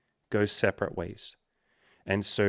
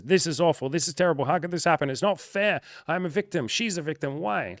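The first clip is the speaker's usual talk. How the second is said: higher, whiny wail of self-pity; inner victim voice; 8 semitones higher than usual. Retold or imitated. Speaker voicing his own inner victim, high and whiny. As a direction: play the hurt inner child crying unfair. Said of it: "that voice inside us that says".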